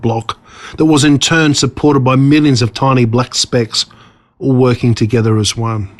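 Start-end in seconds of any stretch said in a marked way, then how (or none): none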